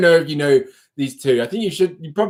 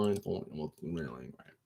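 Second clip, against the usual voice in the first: embarrassed mumble; 11 semitones lower, 18 dB quieter than usual. Real, not acted. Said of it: "not even gonna shame myself".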